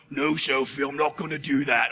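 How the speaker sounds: low put-on giant voice, reluctant; BFG. Scared giant refusing Sophie, low character voice. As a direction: drop deep and refuse like a timid giant. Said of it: "he's, like, just freaking out".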